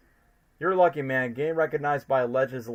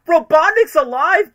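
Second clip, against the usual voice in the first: high slow loud announcement; exclaiming; 14 semitones higher, 10 dB louder, slower than usual. Retold or imitated. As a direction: belt it out like big news. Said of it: "they keep saying".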